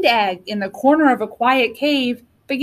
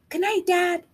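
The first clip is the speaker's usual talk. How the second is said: soft, high little dinosaur whisper; 6 semitones higher, 5 dB quieter than usual. A narrator's reading. Little dinosaur to dad at bedtime, hushed whisper. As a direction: whisper it like a small child at bedtime. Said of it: "whispered Rex".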